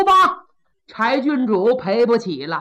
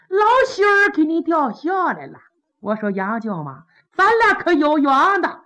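mock-mourning official; 3 semitones higher than usual. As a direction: coax the dead like a sly joker. Kou Zhun harangues the coffin.

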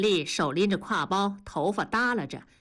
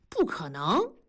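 发愣. Acted. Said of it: "同志傻了".

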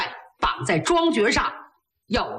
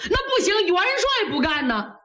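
high-pitched, agitated protest; urgent objection; 8 semitones higher than usual. A narrator's reading. General objects to the emperor's remedy, in urgent protest. Niu Gao rejects the emperor's idea.

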